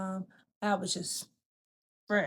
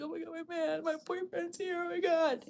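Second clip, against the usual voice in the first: giddy excitement; 9 semitones higher than usual. Retold or imitated. Excited thought on seeing boyfriend.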